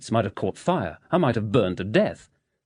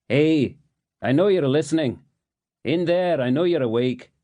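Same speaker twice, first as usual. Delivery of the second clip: louder, higher Scottish taunting through the wall; Scottish accent; 7 semitones higher, 3 dB louder than usual. A narrator's reading. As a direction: needle the hidden neighbour, Scottish. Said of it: "A Scottish accent".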